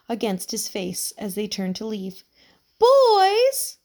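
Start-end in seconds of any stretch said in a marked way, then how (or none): 2.8-3.8 put-on high, loud old lady calling out; feeble high call. A narrator's reading. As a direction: call across the fence like a frail old woman. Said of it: "a feeble, high-pitched voice called".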